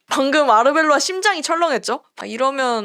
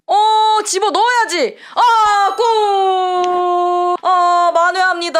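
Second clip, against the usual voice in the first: loud, high goal call with a long-held "골"; 환호하는 골 중계; 5 semitones higher, 5 dB louder, much slower than usual. Real, not acted. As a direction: erupt at the goal and stretch the shout. Equalizing goal called live. (길게 내지르는 골 환호)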